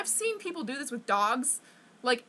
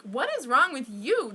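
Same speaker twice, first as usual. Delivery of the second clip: louder, emphatic comeback question; retold indignant retort; 2 semitones higher, 5 dB louder than usual. Child fires back at appalled mother, retold indignant retort. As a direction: throw the scolding back, louder.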